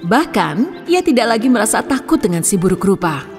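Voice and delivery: usual speaking voice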